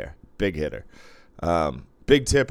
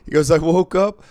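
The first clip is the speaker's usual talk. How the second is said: much higher and louder, astonished; mock shock; 8 semitones higher, 7 dB louder than usual. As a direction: play the buddy floored he was seen. Imitating shocked drunk buddy.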